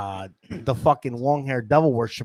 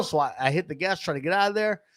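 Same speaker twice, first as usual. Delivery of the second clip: plain reading voice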